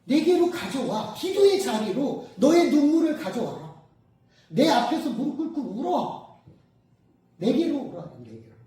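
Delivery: loud, high summons; quoted Jesus. Retold or imitated. Jesus summoning people to prayer, loud urgent call. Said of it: "예수님께서 그러잖아요".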